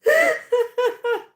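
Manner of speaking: loud helpless cackle; real high-pitched laughing fit. Real, not acted. Streamer cracking up while reading chat aloud, real laughter. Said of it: "I can't even read without laughing".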